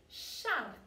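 "Shark" with a long, strong rolled R; emphasized R. Shown as a demonstration. Teacher repeating for children to copy, "Shark" with a heavy R. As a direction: lean on the R in "Shark". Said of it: "really emphasize that R".

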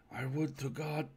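stranger's lightly hushed lament; checking itself suddenly. Acted. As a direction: begin a plea, then stop dead. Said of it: "checked itself as suddenly".

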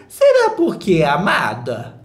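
sarcastic jab. Real, not acted.

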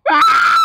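very high, piercing scream; loud scream. Real, not acted. Player falling in the game, screaming.

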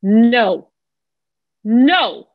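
sharp loud no, no; stern parental no; harsh no. Shown as a demonstration. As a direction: snap no like a cross parent. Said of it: "listen to my word".

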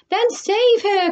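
high, drawn-out plea; alarmed mother, pitched right up; crying out. A narrator's reading. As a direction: cry out for help, slow and high. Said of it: "cried Missus Salt".

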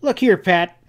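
fierce. A narrator's reading. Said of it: "he went on fiercely".

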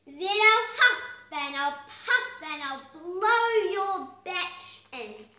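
loud big bad dog threat; threatening sheepdog. A narrator's reading. Sheepdog threatens the second pig, loud and menacing. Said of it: "lashed out with his razor-sharp".

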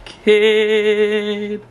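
high, slow sing-song fake weeping; teasing a little brother with mock tears; mock crying. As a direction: pretend to be moved to tears, hammed-up. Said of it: "I'm crying".

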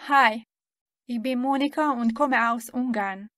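normal talking voice

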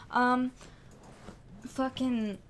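ordinary talk

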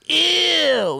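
put-on very high, drawn-out toddler disgust; mock toddler squeal; imitated fussy toddler. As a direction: mimic a little kid showing off a new word. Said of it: "she just learned the word".

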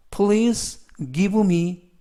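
Please give me drawn out word by word; slow model sentence. Shown as a demonstration. Teacher reading an English example slowly to students. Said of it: "slow English model sentence".

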